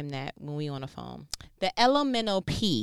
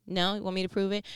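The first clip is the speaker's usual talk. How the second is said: ordinary delivery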